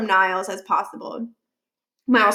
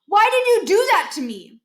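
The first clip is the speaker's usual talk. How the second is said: loud high outcry, faking outrage; cried out; 10 semitones higher, 4 dB louder than usual. Acted. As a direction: yell it out high and loud like the victim. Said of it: "Miles cried out".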